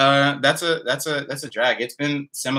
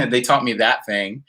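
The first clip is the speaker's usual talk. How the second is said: ordinary delivery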